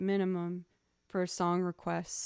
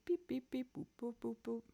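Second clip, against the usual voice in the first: imitating pizzicato; 4 semitones higher, 6 dB quieter than usual. Shown as a demonstration. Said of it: "pizzicato going like boop".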